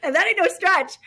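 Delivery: said through a high laugh; real laughter on the words. Real, not acted. Laughing at her own boast.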